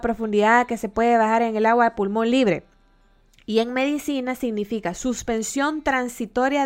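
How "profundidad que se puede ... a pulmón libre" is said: reading a definition. Shown as a demonstration. Reads definition aloud.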